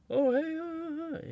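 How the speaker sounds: a starstruck cartoon-mouse pitch; Mickey Mouse voice. Shown as a demonstration. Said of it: "that Mickey Mouse voice".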